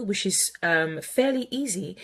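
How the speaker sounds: usual speaking voice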